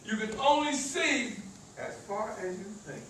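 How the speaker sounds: normal talking voice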